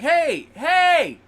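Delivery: sharp high call across the yard; loud high hey hey; shouting at dogs. Retold or imitated. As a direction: yell to stop the dogs.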